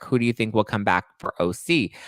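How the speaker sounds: usual speaking voice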